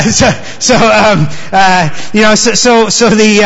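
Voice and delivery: usual speaking voice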